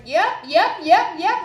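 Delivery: rapid, loud, high interjections; rude talking-over. Retold or imitated. Patient rudely talking over the OB's explanation, impatient interrupting. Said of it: "just talking over her so rudely".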